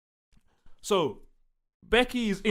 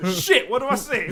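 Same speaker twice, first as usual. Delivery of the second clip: raised, louder voice of someone stuck; mock flustered; 4 semitones higher, 7 dB louder than usual. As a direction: act thrown off, forgetting your lines. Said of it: "And you're like".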